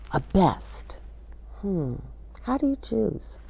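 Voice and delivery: whispered, pondering the choice; musing whisper. Acted. Whispering to children about choosing a favorite, musing. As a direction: breathe it like a secret puzzle.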